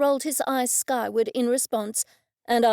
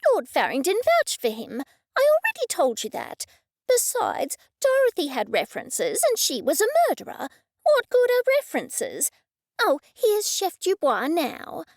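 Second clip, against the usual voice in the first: high, louder indignant retort; indignant snapping; 7 semitones higher, 3 dB louder than usual. Acted. Cressida snapping at Mister Buttons over references, indignant and high. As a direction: brush off his doubts with a huff.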